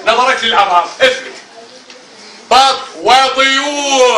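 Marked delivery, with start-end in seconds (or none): 2.5-4.2 slow, drawn-out syllables for the class; slow reading model. Shown as a demonstration. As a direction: model it slowly, word by word. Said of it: "teacher models the reading".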